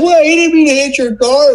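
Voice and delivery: very high, whiny imitation of his wife; husband mocking his ex-wife's plea for the deer, very high and whiny. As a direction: parody her naive protest in a squeaky voice. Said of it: "and she's like".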